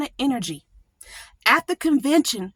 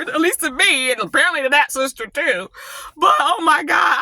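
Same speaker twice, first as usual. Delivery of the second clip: ordinary talk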